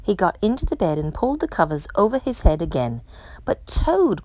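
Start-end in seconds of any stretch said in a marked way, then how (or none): 3.5-4.2 mild storybook cry. A narrator's reading. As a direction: plead with him, pitched up. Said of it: "cried Frog".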